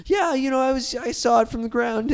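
high-pitched imitation of a cornered teen; nervous cover-up. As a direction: squeak out an innocent excuse.

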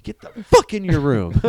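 mock parental scolding, building from wounded to exasperated; half-laughing, hammed-up fatherly reproach; disappointed dad. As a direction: play a let-down dad telling off his kid. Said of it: "Like being the dad".

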